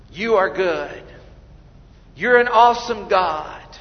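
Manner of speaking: a hint of pompous, flowery praying; showy impressive prayer. Preacher mocking a prayer meant to impress God, lightly pompous. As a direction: lay the praise on thick to impress. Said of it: "I pray as though I'm trying to impress God".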